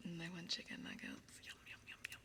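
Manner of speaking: whispering. Acted. ASMR whisper.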